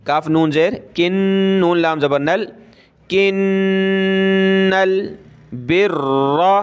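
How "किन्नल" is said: किन्नल with the doubled नून hummed in the nose; nasal ghunna. Shown as a demonstration. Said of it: "नून पे गुन्ना होगा तशदीद की वजह से". (किन्नल में नून पर गुन्ना)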